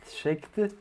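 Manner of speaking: "checked" said the English way, one syllable, ending on a clipped t; English accent. Shown as a demonstration. Coder repeating his state name, in a clumsy English accent. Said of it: "Désolé pour mon accent anglais abominable".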